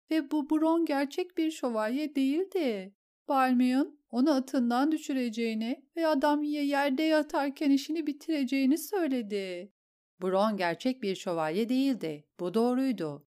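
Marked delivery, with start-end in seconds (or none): 0.1-2.9 higher, tearful voice on "Ve bu Bron gerçek bir şövalye değildi"; a shaken noblewoman pleading her husband's reasoning; distraught lady. Acted. Lady repeats her husband's words to the queen, tearful and high. 3.3-9.7 higher, stammering, tearful lady's voice; a shaken noblewoman stumbling over her words; distraught lady. Acted. Lady explains the duel plan to the queen, tearful and stammering.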